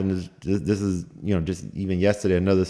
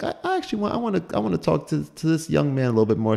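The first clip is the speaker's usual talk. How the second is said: high, lightly voiced old lady; a hint of an old lady, pitched up; old lady quote; 9 semitones higher, faster than usual. Old teacher praising the young man, lightly voiced high. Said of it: "She's like".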